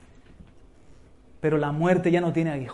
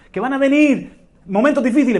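higher, louder first call; emphatic warning; 6 semitones higher, 6 dB louder than usual. Real, not acted. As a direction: proclaim it up high. Preacher repeats warning phrase.

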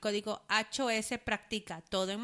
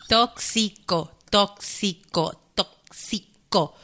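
slow practice; 3 semitones lower, 10 dB louder, much slower than usual. Real, not acted. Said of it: "¿Por qué no puedo decirlo?".

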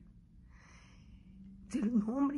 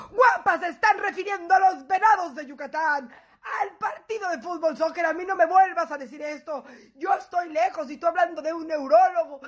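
louder, lightly voiced scolding husband; husband's voice; 3 semitones higher, 7 dB louder than usual. Husband retorting over the misunderstood message, louder. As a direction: play the alarmed husband telling her off. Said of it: "si hubieran oído la voz del guapo".

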